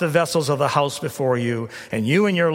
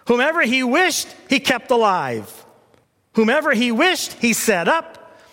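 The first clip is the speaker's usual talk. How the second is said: raised, emphatic scripture read; 6 semitones higher than usual. Acted. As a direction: proclaim the verses with rising force. Reads scripture with building force.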